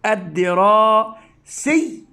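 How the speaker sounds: الدراسي drawn out syllable by syllable, rising; slow syllable reading. Shown as a demonstration. Teacher sounding out a word for children, slowly. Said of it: "فأقول".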